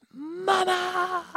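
high, imitating a toddler's yell for mama; mimicking a toddler shouting from her bed. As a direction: call out like a demanding little kid. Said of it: "she just yells".

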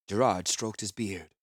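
plain reading voice

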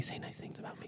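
whispered plea, barely voiced; a sly whispered aside. Real, not acted. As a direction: breathe it as a secret request. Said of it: "trying to get you to say nice things".

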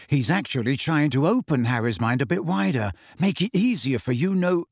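ordinary delivery, nothing marked

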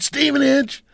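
high, loud delighted exclamation; excited recognition. Retold or imitated. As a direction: burst out in amazed recognition. Principal excited by hometown.

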